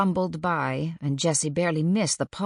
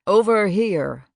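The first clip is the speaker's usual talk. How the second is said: much louder, slow call over the crowd; shouting to the children; 4 semitones higher, 9 dB louder than usual. A narrator's reading. Father calling his children over the crowd, loud slow shout. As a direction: call out across the wharf to the kids. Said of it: "before shouting and waving to the children".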